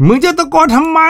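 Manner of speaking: grandma scolding the grandchild for shouting, high mock voice; deaf old mother snapping. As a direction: squawk it back like a hard-of-hearing mother. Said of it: "คุณหลานว่า".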